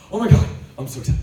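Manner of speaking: fast, loud, high gushing; mock excitement. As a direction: gush with childlike joy. Excited about new sister.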